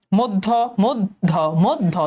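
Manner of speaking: মধ্য slowly split as "mod-dho"; doubled d. Shown as a demonstration. Teacher drilling the example, slow মধ্য as "mod-dho". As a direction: draw out each মধ্য on the double d. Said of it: "দয়ের উচ্চারণ দুইবার হচ্ছে".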